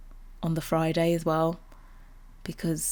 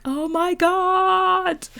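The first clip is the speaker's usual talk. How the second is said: much higher, slow, stretched-out awe; hyped gasp; 12 semitones higher, much slower than usual. Retold or imitated. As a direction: drag it out in amazed delight. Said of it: "Way too hyped at all the new stuff".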